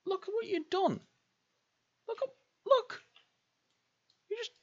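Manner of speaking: very high, hammed-up reproach; squeaky scolding. Scolding self over the damage.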